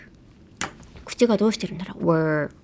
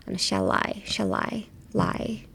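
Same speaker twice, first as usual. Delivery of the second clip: ordinary delivery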